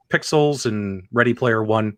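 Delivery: usual speaking voice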